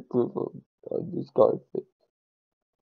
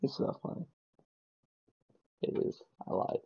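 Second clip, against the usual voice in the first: whispered joke; 13 dB quieter, faster than usual. Real, not acted. Hushed joking.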